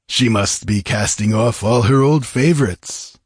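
usual speaking voice

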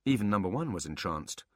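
normal talking voice

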